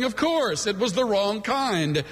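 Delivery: usual speaking voice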